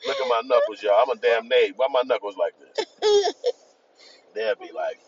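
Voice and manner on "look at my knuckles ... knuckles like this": a hint of a brash maid, a little higher and louder, laughing; lightly voiced confrontational maid; mock angry maid. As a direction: boast like a tough maid showing knuckles. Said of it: "her maid come out like".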